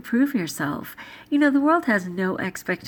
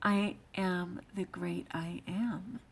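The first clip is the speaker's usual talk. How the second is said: reverent quote; 2 semitones lower, 9 dB quieter, slower than usual. Retold or imitated. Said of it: "how God said".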